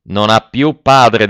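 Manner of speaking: slow, loud and final; a furious old miser disowning his daughter; slow stern declaration. A narrator's reading. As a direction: hammer each word down like an angry father. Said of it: "disse il bottaio".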